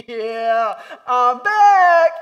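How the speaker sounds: loud high burst, hammed-up; playful yell from the pulpit; mock shout. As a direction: blast it out like a joke comeback. Pastor mock-shouts after laugh.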